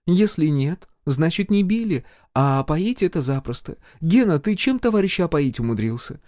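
high-pitched female healer, chiding lightly; healer, unbothered teasing. A narrator's reading. Healer chides Gena and teases the nervous major, unfazed.

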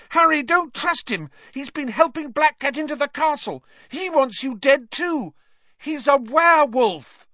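high, loud, frantic warning; screaming. A narrator's reading. Hermione warning Harry about Lupin, screaming. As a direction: scream the warning, building to a revelation. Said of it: "Hermione screamed".

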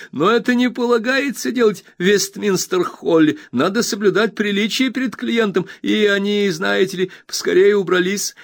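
high, bright-eyed friend overjoyed; eager Traddles. Acted. Traddles reassures his old friend Copperfield, eager high voice. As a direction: gush with happiness at the reunion.

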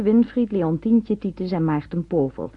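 ordinary delivery

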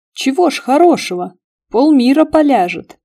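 arguing. A narrator's reading. Karavaev objects to teaching her to fell enemies, argumentative. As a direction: argue back emphatically. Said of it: "заспорил Караваев".

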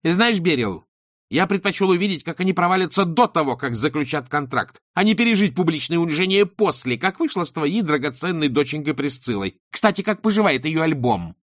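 high, loud angry counterattack; stung into a biting retort; angry attack. A narrator's reading. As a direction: lash back at her. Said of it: "вдруг рассердился и бросился в атаку".

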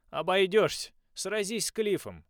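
loud, high slow order; commanding. A narrator's reading. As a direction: snap the command at him. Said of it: "велел тренер".

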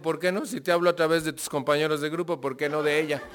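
a playful divine comeback; God's reply. Retold or imitated. Speaker voicing God's answer to his doubt, lightly amused. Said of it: "Y me dijo".

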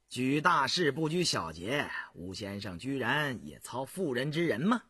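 ordinary delivery

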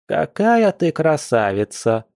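slow, high, admiring lilt; drawn-out singsong old woman; surprised singsong. A narrator's reading. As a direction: croon it in delighted surprise. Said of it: "удивлённо пропела старушка".

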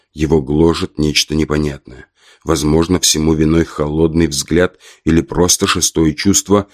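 plain reading voice

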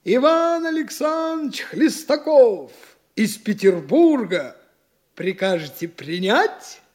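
grand, drawn-out footman's announcement; announcing lackey. Acted. Daydreamer plays a lackey announcing himself at a manor, pompous announcement. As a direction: announce a guest pompously, stretching each name. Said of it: "А лакей входит".

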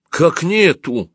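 high, drawn-out question; startled. A narrator's reading. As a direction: react in disbelief to bad news. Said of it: "чуть не подпрыгнул я".

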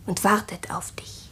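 breathy eerie whisper; hushed, ominous whisper. Acted. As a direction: whisper it like a secret dread. Eerie hint of ghost waiting.

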